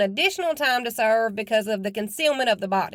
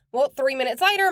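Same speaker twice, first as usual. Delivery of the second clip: normal talking voice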